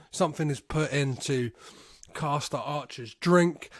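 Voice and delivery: normal talking voice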